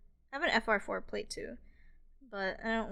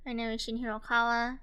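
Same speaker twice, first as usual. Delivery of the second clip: ordinary talk, nothing marked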